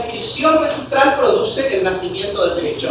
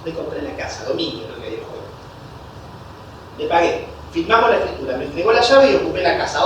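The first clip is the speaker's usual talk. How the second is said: softer, lower run-through of the steps; quick recap; 5 semitones lower, 5 dB quieter than usual. Shown as a demonstration. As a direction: recap it under your breath. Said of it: "Repito rápido".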